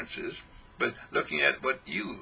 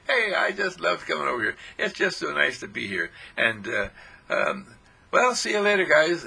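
bright, louder, higher farewell; chirpy see-you-later; breezy cheerful goodbye; 4 semitones higher, 4 dB louder than usual. Pretend. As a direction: wave off happily.

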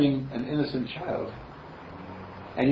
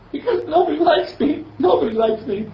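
put-on high, louder whining child with a laugh; sulky little girl; 5 dB louder than usual. Little girl insists to Mister Candle, pouting and high. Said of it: "why do you think nobody likes you".

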